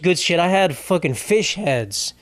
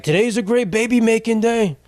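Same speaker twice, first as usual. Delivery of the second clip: higher put-on mayor voice, chirpy; Eric Adams impression; 6 semitones higher than usual. Mayor on TV urging New Yorkers to stay in, put-on higher voice. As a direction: mimic the mayor selling a snow day, pitched up. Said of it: "I can't do an Eric Adams impression".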